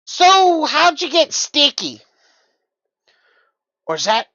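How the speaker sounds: put-on bright high playful girl voice; high girly voice.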